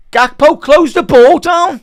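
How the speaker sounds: loud, high, slow yell at the player; real frustrated shout at the telly; calling out. Real, not acted. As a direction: bark an order at the screen. Yelling at player on screen.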